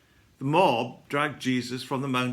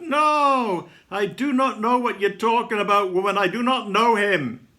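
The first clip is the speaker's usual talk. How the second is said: loud, high panicked denial; frantic, high-pitched shout of denial; fearful cry; 7 semitones higher, 9 dB louder than usual. A narrator's reading. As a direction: cry out in fear, denying it at full voice. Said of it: "In fear, I cry out".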